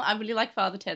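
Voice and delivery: ordinary talk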